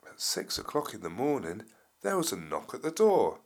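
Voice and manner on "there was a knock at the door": ordinary delivery, nothing marked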